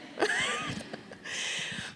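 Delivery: high breathy laugh. Real, not acted. Laughing at the judge's tissue offer, light laugh.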